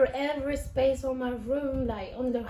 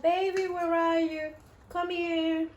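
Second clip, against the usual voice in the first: higher, louder coaxing call; calling a pet; 5 semitones higher, 4 dB louder than usual. Retold or imitated. Owner calling their lost ferret, high calling voice. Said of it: "when I call them".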